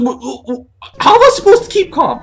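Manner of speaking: stammer, then a very high loud panicked shriek. Retold or imitated. Craig stammers and shrieks back at his coworker in panic. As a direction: sputter then shriek in terror.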